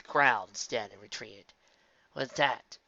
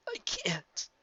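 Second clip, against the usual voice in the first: high, softer resigned voice; 8 semitones higher, 5 dB quieter than usual. A narrator's reading. Spike admitting defeat to Twilight. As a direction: give up quietly.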